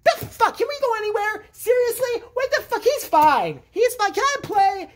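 high-pitched, whiny outburst; hammed-up indignant complaining; exasperated kid. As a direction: protest in a squealing fed-up voice. Exasperated brother complaining about search.

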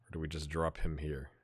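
unvoiced whisper to himself; whispered muttering. Real, not acted. Manager whispering tactical changes to himself. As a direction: think aloud under the breath.

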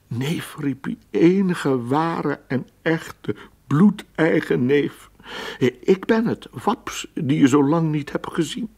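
embrace your long-lost cousin with your voice; joyful reunion cry. Acted. Waps hugs his real cousin.

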